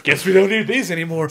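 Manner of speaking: acted manager. Manager torching the console blueprints, hammed-up announcement. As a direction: call it out over the burning blueprints, hammed-up.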